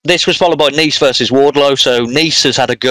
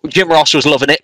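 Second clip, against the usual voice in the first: ordinary talk, nothing marked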